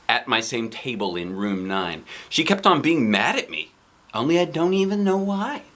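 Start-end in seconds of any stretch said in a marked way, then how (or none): none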